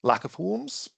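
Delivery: ordinary delivery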